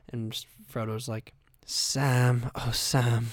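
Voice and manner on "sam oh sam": a lightly voiced, breathy-free swooning Frodo; weird Frodo sigh. Comic impressing Frodo sighing at Sam, lightly swooning. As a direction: sigh the name like a lovesick hobbit. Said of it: "he always says it ... the most weird way".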